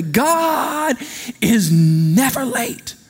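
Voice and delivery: slow, drawn-out preacher proclamation; imitating a preacher. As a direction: lift GOD high and stretch it out grandly. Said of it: "I heard a preacher say".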